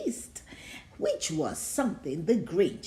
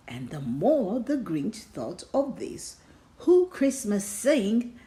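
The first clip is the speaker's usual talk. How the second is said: usual speaking voice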